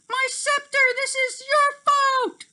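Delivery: high, loud, furious cry; angry. A narrator's reading. Tink blaming Terrence for the broken scepter, loud high angry cry. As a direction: shriek the blame at him. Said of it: "Tinkerbell was really angry".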